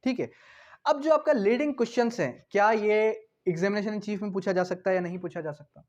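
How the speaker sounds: ordinary talk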